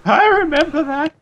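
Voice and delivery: put-on very high old woman's voice; really old Sonya. Talking head recalls the past, very old woman voice. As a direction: reminisce in a squeaky old-lady pitch. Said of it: "really old Sonya".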